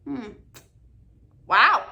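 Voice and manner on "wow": loud surprised wow. Real, not acted. Reacting to dead dog trivia.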